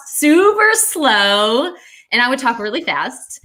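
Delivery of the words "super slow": exaggerated slow-talker demonstration; drawn-out slow. Shown as a demonstration. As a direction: stretch both words out, louder and higher. Illustrates roommate's slow talking.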